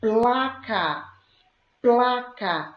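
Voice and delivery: "Placa" drawn out, PL hit firmly; P-L cluster emphasized. Shown as a demonstration. Teacher showing the P L cluster, emphatic word demo. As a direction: sound out "placa" with a clear, stretched PL start. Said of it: "o encontro consonantal P L".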